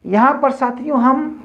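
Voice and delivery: normal talking voice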